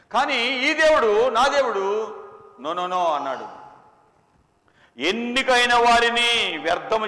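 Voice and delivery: loud, high, slow pulpit proclamation; fervent preacher's cry; preaching at full force. Acted. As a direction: thunder it out to the congregation. Preacher proclaims God's choice.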